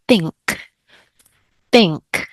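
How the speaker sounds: Think, said with a clear th and a falling tune; teacher showing the student how to say Think; clear model pronunciation. Shown as a demonstration. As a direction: say Think carefully, twice, so they can copy it. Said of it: "You have to really pronounce it for them".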